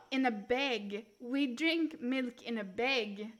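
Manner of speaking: "bag" said as "beg"; Canadian "bag". Shown as a demonstration. Correcting to Canadian speech, "bag" sounding like "beg". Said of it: "they say bag".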